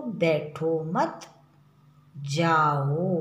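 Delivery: बैठो मत run together, pause, long जाओ; pause after मत. Shown as a demonstration. Teacher demonstrating where the comma falls, pause after मत. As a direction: break after मत, then draw out जाओ. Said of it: "जाने के लिए कह रहा है".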